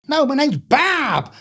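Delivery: slow, high mock Washington accent, "Bob" stretched as "Bahb"; exaggerated American Washington voice on "Bob". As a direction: insist like a Washington man, drawling "Bob". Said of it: "you're saying Bob".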